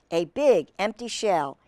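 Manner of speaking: usual speaking voice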